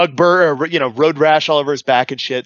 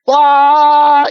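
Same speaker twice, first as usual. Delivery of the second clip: long, high, loud held yell; imitated engine roar; 13 semitones higher, 8 dB louder than usual. Driver imitating his supercharged truck, loud sustained blare. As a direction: imitate a turbo diesel at full throttle. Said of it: "diesel, just like".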